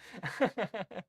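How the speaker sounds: high laugh bursting out; helpless little laughing fit; real amused laugh. Real, not acted. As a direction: laugh at what you just read. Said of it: "sorry, I'm laughing".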